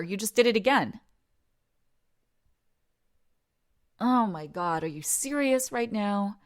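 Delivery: loud, exasperated disbelief; incredulous outburst. Acted. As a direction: call them out loudly, scandalized. Acted outburst over the whimper.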